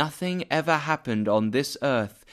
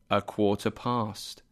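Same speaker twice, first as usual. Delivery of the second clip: usual speaking voice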